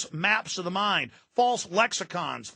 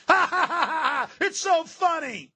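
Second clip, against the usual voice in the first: high-pitched mock cackle, stretched out; exaggerated fake laugh, pitched way up; mocking laughter; 10 semitones higher than usual. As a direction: imitate gloating elites laughing at the dupes. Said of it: "Laughing, going".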